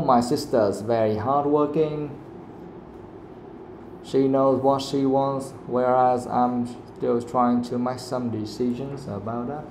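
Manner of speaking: even, unemphasized reading, "hardworking" and "still" given no weight; no stress. Shown as a demonstration. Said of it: "Can I just say it's normal?".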